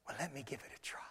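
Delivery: low, barely voiced offer; discreet whisper. [name] offers to take the child, discreet whisper. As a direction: whisper it so the service carries on.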